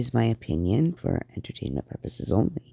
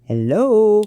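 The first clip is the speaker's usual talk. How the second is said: exasperated hello; 10 semitones higher, 6 dB louder than usual. Retold or imitated. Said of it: "reach through the phone and back at myself".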